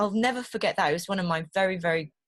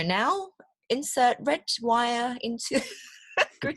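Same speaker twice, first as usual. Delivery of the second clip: lightly voiced step-by-step guide, slow and higher; instructional voice; 6 semitones higher, slower than usual. Shown as a demonstration. Voice talking someone through building stuff, slow instructional delivery. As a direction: slowly guide a builder, breaking into a laugh. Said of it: "this voice talks you through it".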